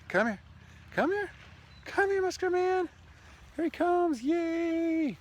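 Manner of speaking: squeaky high call to the dog, sing-song and loud; high doggy-talk voice. Real, not acted. Man calling his dog over on the beach, high squeaky baby talk.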